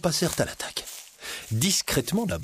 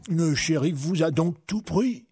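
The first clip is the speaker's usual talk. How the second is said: put-on higher-pitched old beggar; disguised beggar; 5 semitones higher than usual. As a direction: question them in a put-on beggar's voice.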